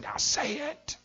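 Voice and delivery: father's menacing demand. Retold or imitated. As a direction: threaten her into saying it. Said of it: "He said".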